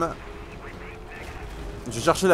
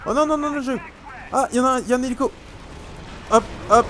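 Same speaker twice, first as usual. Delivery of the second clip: high rushed panic; panicked; 10 semitones higher, much faster than usual. Real, not acted. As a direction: blurt it out in a scramble. Panicking then spots helicopter.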